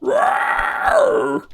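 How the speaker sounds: high shouted monster roar; playful monster growl. Pretend. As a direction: roar like a cartoon Godzilla. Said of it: "Time to be Godzilla".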